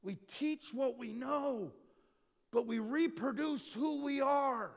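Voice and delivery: slow, high, preaching emphasis; emphatic restatement. Real, not acted. Preacher restates a key saying to the congregation, emphatically.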